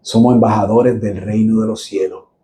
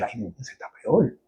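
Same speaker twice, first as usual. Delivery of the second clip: hushed aside; 13 dB quieter than usual. Retold or imitated. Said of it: "Yo dije".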